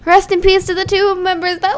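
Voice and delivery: ordinary talk, nothing marked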